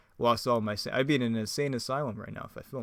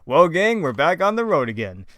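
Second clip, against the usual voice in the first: loud cartoon leader, pitched up at the start; hammed-up rallying call to the group; Fred; 6 semitones higher, 10 dB louder than usual. Fred telling the gang they're travelling, loud put-on voice. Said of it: "my best Fred impression".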